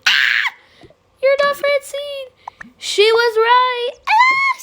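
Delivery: high, loud panicked shrieks; shrieking when the zombie appears; terrified screams. Acted. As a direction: scream in terror.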